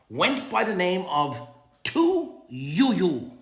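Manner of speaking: slow, loud, dramatic announcement of the name; game-show style name reveal; drawn-out name reveal. Acted. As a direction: draw out Tu Youyou like a grand reveal.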